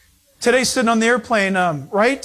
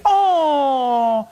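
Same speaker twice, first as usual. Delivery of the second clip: long, higher "aww" sliding down; cooing; 5 semitones higher than usual. Retold or imitated. Woman passenger admiring a stranger's newborn, cooing. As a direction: mimic a woman melting over a baby.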